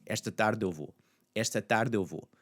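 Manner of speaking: "tarde eu" run together as one in "esta tarde eu vou"; blended vowels. Shown as a demonstration. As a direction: link the swallowed e of "tarde" into "eu". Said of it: "it's largely gonna blend in with that tarde".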